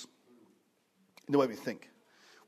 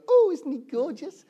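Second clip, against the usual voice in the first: put-on much higher, sing-song baby coo; cooing over a baby; 12 semitones higher, 4 dB louder, slower than usual. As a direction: gush like someone admiring a newborn. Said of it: "don't you go".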